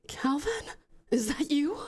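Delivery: high, hushed and frightened; fearful whisper. Acted. Anaconda waking to see his dead brother, fearful whisper. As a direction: ask it barely breathing. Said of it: "he says in fear".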